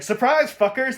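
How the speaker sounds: high, loud gotcha shout; loud mock announcement; mock triumphant entrance. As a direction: burst in yelling, imitating a surprise reveal. Retold Batman bursting in.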